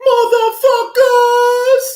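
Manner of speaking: very high-pitched, loud, drawn-out caricature. Shown as a demonstration. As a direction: put on a squealing, stretched mock voice.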